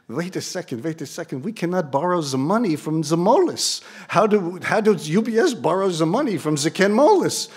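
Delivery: high-pitched mock Swiss accent; imitated Swiss accent. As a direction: protest in a thick put-on Swiss accent. Acts out a foreign banker objecting.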